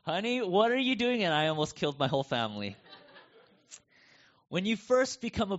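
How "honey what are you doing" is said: high, louder mock alarm; alarmed wife. Wife in the car as he drives on the wrong side, mock alarmed. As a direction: imitate the wife's startled cry. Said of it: "my wife was like".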